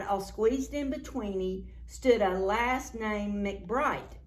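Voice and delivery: normal talking voice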